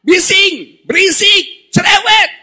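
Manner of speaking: loud, high scolding shouts; irritated yells, one word at a time; crowd silencing someone. Acted. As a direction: tell a noisy beggar to shut up. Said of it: "Orang-orang berkata".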